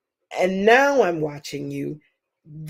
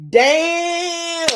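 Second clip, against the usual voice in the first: loud, high, drawn-out whoop; excited exclamation; 12 semitones higher, 6 dB louder than usual. Real, not acted. Host introducing two followers to each other, wowed shout.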